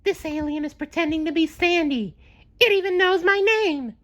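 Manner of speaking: very high, alarmed cartoon sponge; SpongeBob voice. A narrator's reading. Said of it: "he warned Patrick".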